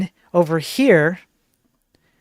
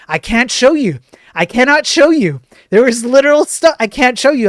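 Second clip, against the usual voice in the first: growing louder and higher with each repeat; building exasperation; 6 semitones higher, 6 dB louder than usual. Real, not acted. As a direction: push it up louder every time. Repeats he cannot show it.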